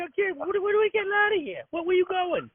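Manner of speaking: high-pitched mock pestering; exasperated nagging. Worker griping about being followed around, high exasperated nagging.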